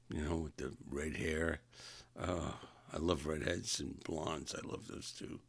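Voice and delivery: normal talking voice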